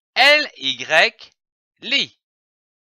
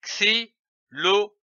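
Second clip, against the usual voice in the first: "xylophone" broken into separate syllables with pauses; "xylophone" in detached reading-lesson syllables; 3 semitones higher, 4 dB quieter than usual. Shown as a demonstration. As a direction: sound out "xylophone" one syllable at a time. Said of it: "L'association de ces syllabes donne".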